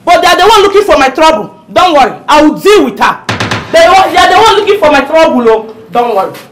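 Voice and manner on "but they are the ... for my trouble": loud, quick, high-energy complaint; aggrieved rant. Acted. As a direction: rail against troublemakers while comforting. Mother rants about neighbours while consoling.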